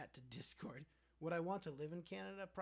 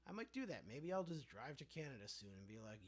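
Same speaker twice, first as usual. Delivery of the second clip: ordinary delivery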